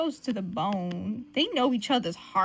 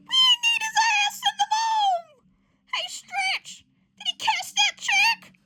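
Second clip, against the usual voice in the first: very high, squealed shout; hammed-up yelling; 5 dB louder than usual. Ben hollering to Stretch about the check, hammed-up yell. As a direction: call out in comic uproar.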